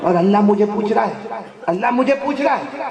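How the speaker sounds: building preaching. Acted. Preacher builds repeated phrase. (بڑھتا ہوا جوش)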